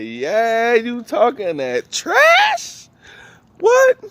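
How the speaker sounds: mock-threatening principal confronting the kid; stern principal. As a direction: drag it out like a principal catching a kid, then a high sharp What. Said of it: "Mister Jones was like".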